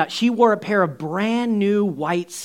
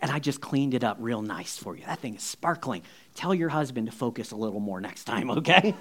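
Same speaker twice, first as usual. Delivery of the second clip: low, soft, confidential aside; discreet confiding; 6 semitones lower, 7 dB quieter than usual. Retold or imitated. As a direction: drop your voice as a tactful royal guest. Queen confides to host.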